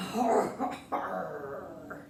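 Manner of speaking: hammed-up hearty har har har; a big belly laugh like a pirate. Pirate laughing at his own pun, hammed-up hearty laugh.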